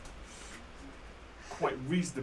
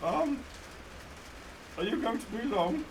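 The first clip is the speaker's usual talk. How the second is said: put-on old man voice; 6 semitones higher than usual. Old man asks at toilet.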